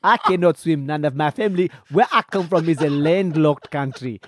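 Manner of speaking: put-on indignant comic Nigerian accent; Nigerian prince accent. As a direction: protest in a mock Nigerian accent. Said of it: "Do a, do the Nigerian".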